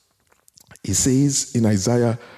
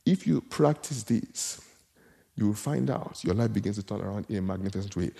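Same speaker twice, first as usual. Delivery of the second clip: soft and sincere; 5 semitones lower, 8 dB quieter than usual. Acted. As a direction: ease down and reassure them. Softened promise to the congregation.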